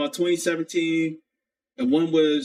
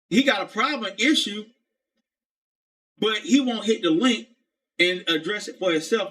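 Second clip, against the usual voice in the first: usual speaking voice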